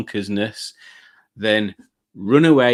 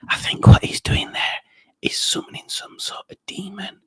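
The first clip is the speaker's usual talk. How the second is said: conspiratorial stage whisper; 6 semitones higher than usual. Pretend. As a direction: whisper it like a dark secret. Conspiratorial aside about Rob.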